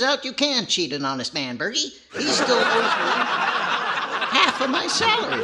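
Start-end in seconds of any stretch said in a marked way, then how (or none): 2.1-5.4 laughing it out higher and louder; half laughing, half griping; laughing complaint. Acted.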